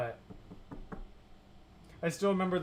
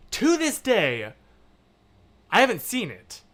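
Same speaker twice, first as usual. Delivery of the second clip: yelling; 5 semitones higher, 7 dB louder than usual. Real, not acted. Viewer recalling a scary movie, yelling in emphasis. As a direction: get carried away, loud and emphatic. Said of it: "Why am I yelling?".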